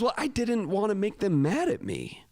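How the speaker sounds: defensive student. Retold or imitated. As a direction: plead your case, lightly acted. Retells student's defensive answer.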